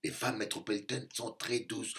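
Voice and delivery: ordinary talk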